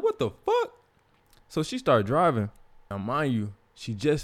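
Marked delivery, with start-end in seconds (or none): 0.0-0.7 squeaky falsetto shriek of disbelief; shocked disbelief. Retold or imitated. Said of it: "I'm like".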